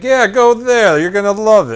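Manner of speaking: high, loud mocking local voice. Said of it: "It's like".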